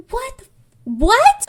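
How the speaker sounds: high, loud, drawn-out disbelief; hammed-up stunned outburst; acted astonishment. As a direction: squeal it in baffled shock. Acted astonishment at her self-control.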